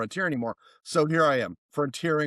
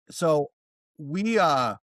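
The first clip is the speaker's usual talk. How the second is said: usual speaking voice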